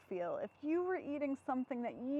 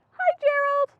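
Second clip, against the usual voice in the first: lightly voiced cheerful pig, higher; chirpy little pig saying hi; Piggy voice; 12 semitones higher, 11 dB louder than usual. Piggy greeting her friend Gerald, light cheerful pig voice.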